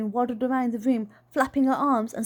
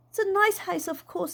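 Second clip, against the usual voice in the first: put-on little girl voice; 8 semitones higher than usual. Voices the child.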